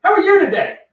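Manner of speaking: perky morning hello from the office optimist; bubbly office coworker. Retold or imitated. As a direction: sing it out like the too-cheerful one at the office. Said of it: "the bubbly one in the office".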